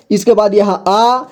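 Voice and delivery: usual speaking voice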